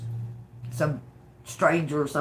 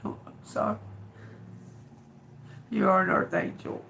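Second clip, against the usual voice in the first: breathy, unvoiced through tears; apologising while fighting back tears; overwhelmed, tearful; 7 dB quieter, faster than usual. Real, not acted. Reader apologises to viewers, overwhelmed, whispering through tears. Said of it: "It's just a little overwhelming".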